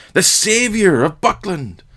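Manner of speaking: high, slow, stretched-out cheer; acclaiming yell. A narrator's reading. Another tavern-goer hails the hero, calling out. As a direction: hail the hero in a long, high call. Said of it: "And another responded".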